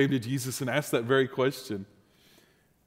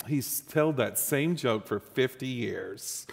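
slow, softer, fed-up wife; weary eye-roll; 3 semitones lower, 6 dB quieter, slower than usual. Retold or imitated. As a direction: sigh it out like she's heard it forever. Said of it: "rolled her eyes and goes".

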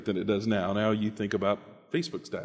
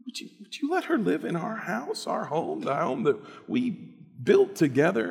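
much higher pleading wife; upset wife; 9 semitones higher than usual. Acted. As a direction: demand it in disbelief. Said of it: "She said".

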